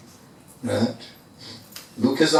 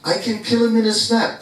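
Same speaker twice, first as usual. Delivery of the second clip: higher, fast, cocky brag; boastful Hanuman; 8 semitones higher, faster than usual. Acted. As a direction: brush it off like it's nothing, quick and proud. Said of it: "And Hanuman says".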